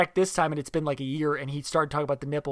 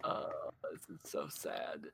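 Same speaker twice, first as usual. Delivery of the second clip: quiet sad remark; 19 dB quieter than usual. Real, not acted. Watching the video.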